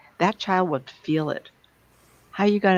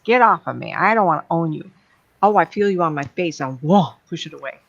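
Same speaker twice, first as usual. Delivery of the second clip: loud, blunt brush-off; rude rebuff; 6 dB louder than usual. Shown as a demonstration. Teacher showing how to refuse unwanted energy, rude rebuff. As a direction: shove it away with your voice, punchy and rude. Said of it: "sometimes you can just be rude and say".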